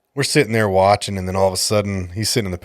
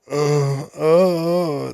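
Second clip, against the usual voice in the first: high mock whimpering uh, much higher; a mocking high-pitched moan; 6 semitones higher than usual. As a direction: whimper like he's in labour. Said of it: "he sounded like a fucking pregnant female".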